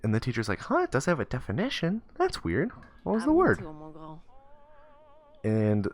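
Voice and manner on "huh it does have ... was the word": high, lilting teacher voice; puzzled teacher. Retold or imitated. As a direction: play a teacher curious with a child, pitched up. Teacher puzzled by boy's question.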